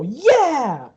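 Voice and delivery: high, loud cheer with arms up; cheering yell. Acted. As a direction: whoop like an excited fan. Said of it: "throw their arms up and go".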